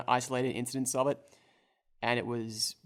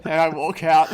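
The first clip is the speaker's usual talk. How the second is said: laughing; 4 semitones higher, 14 dB louder than usual. Real, not acted. Player walks out, big laugh.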